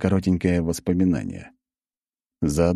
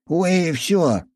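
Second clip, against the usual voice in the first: indignant huff; 12 semitones higher than usual. Acted. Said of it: "возмутилось божество".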